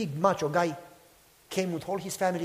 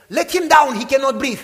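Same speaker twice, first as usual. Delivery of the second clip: frantic shout; 7 semitones higher, 12 dB louder than usual. Retold or imitated. Translator yells at the big man.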